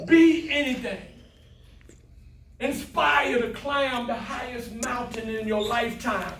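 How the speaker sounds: a stirring, rising motivational shout; rousing exhortation. Real, not acted. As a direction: lift them up like a pastor closing.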